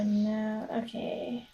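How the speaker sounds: normal talking voice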